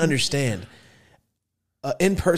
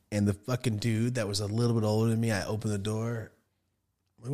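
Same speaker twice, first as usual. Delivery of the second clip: ordinary talk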